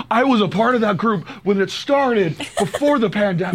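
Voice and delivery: high-pitched mock quote of a coworker; imitating Buzz. Cohost mimics Buzz boasting about the walking group, high mock voice. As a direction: put on a high voice to quote a colleague. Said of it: "And Buzz was like".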